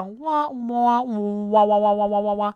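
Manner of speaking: mock the group's whiners; mock whining wahs. Mocking whiners.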